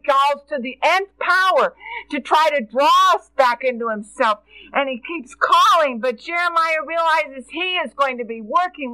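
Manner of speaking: full-force preaching to the congregation; fervent preaching. Acted.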